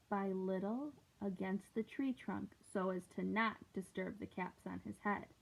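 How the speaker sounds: usual speaking voice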